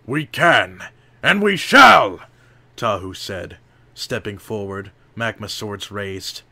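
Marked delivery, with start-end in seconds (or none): 0.1-2.2 loud, high, building battle cry; rally against the darkness; defiant shout. A narrator's reading. Hero defies villain.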